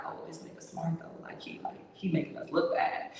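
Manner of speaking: soft unvoiced murmur among the group; grudging whisper to each other; whispered aside. Men mutter among themselves.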